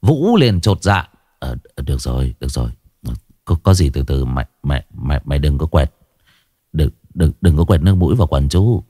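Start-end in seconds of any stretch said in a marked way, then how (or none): 1.4-8.8 gruff cop Vũ. Acted. Vũ brushing off the crying boy, low and gruff. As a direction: talk down to the snivelling kid.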